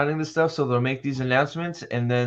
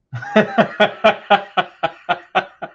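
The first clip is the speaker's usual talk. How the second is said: burst of laughter; 8 semitones higher, 6 dB louder than usual. Real, not acted. Breaks down reading news.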